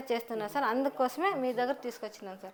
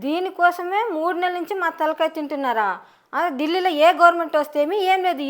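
louder, fed-up wife yelling at her husband; angry scolding wife; 3 semitones higher, 7 dB louder than usual. Acted. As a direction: let three months of frustration burst out loud. (భర్తపై విసిగిపోయి గట్టిగా అరిచే భార్య)

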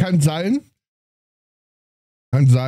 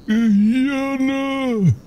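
drawn-out zombie moan; 6 semitones higher than usual. Pretend. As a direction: moan it out like the undead. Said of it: "Die läuft wie ein Zombie".

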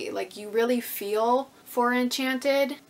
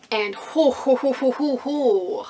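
excited hooting; 4 semitones higher, 6 dB louder, slower than usual. Real, not acted. Excited over a beautiful book.

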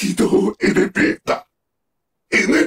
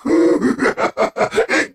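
rising sinister chuckle; villainous chuckle; 4 semitones higher than usual. Acted. Villain about to begin a new world, sinister chuckle. Villain laughs between taunts.

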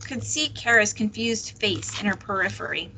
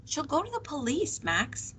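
high, softer, anxious plea; tearful pleading; 7 semitones higher, 5 dB quieter than usual. A narrator's reading. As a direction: plead with Max, close to tears. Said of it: "tears pooling in the grooves around her nose".

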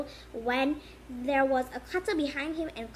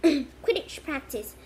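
ordinary delivery, nothing marked

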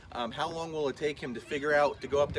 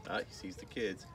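ordinary talk, nothing marked

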